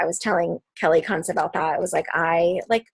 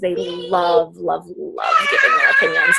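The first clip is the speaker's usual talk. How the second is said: gushing emphasis; 3 dB louder, slower than usual. Real, not acted. Coach telling her team how much people love to comment, gushing. Gushing about audience engagement.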